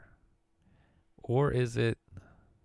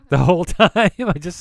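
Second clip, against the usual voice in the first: laughing; 7 semitones higher, 10 dB louder than usual. Real, not acted. Laughing with friend.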